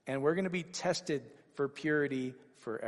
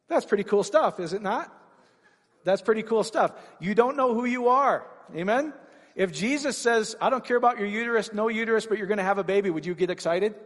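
preaching at full force; 7 semitones higher, 6 dB louder than usual. Acted. Preaching climax to congregation.

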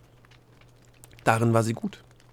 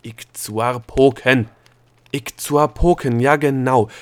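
slow, much louder shout; full-force drawn-out scream; screaming with all her strength; 2 semitones higher, 8 dB louder, slower than usual. A narrator's reading. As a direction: yell the word with everything she has. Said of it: "schrie das Wort mit aller Kraft weiter".

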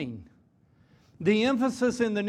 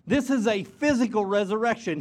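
ordinary talk, nothing marked

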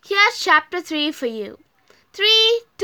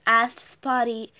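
ordinary delivery, nothing marked

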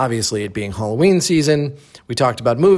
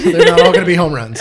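laughing; 3 semitones higher, 9 dB louder than usual. Real, not acted. Laughing at own joke.

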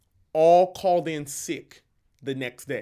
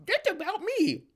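high squeaky mock realization, much higher; guilty reader. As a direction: caught out, said in a put-on high voice. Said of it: "and you're like".

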